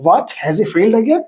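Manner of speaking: surprised question, rising sharply on "again"; mild surprise. Shown as a demonstration. Teacher reading out an exclamatory pronoun example, mildly surprised. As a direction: ask it slowly in mild disbelief. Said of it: "shows surprise".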